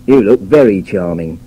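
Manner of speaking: dry sarcastic compliment, stress on very; sarcastic "You look very charming" with a lean on "very"; bouncy sarcasm. Shown as a demonstration. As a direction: say it as firm disapproval dressed as praise. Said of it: "who uses bouncy sarcasm".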